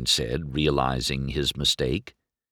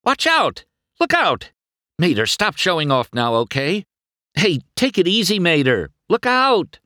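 high, loud, fast alarmed calls; alarmed shouting; 12 semitones higher, 5 dB louder than usual. A narrator's reading. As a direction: yell warnings in panic. McQueen alarmed by reckless driving.

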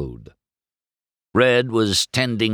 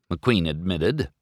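plain reading voice

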